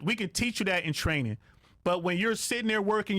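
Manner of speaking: ordinary talk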